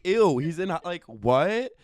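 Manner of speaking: high, loud grossed-out outburst; disgusted shock. Retold or imitated. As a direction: recoil in disgust. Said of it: "I'm like".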